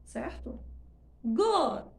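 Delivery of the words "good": good stressed with the final d dropped; good, end suppressed. Shown as a demonstration. Teacher demonstrating the stressed vowel. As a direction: say good strong and leave off the d. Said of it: "exploração tangível".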